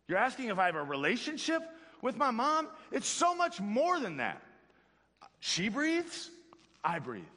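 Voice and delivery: put-on a know-it-all baby scoffing at the question; smart baby. Pretend baby in the womb answers the pastor, mock incredulous. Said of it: "the baby's gonna look at you and be like".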